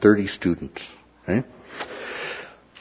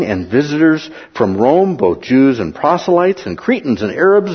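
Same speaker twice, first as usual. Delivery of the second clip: louder, proclaiming list of nations; scripture reading; 4 semitones higher, 3 dB louder than usual. Acted. Preacher reading Acts to the congregation, proclaiming. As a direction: read the nations out with rising force.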